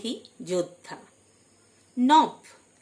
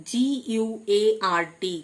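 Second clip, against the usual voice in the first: ordinary delivery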